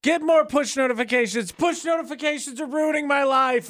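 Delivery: high mock shouting; mocking impression. Mocking McCall's contradiction.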